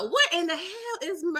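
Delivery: normal talking voice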